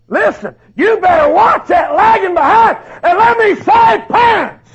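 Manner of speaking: loud, high, hammering sermon delivery; fiery preaching. Acted. Preacher warns parents and young people about wolves, at full force. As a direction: preach it hard to the congregation.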